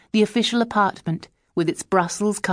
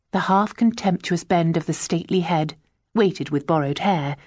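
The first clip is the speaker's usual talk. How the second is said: ordinary delivery, nothing marked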